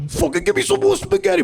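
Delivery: very high mock-angry Italian American demand; mad Italian. Comic mocks Italians mad for spaghetti, high angry voice. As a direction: squeal for food like an angry little guy. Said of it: "They're so mad, sucking on spaghetti".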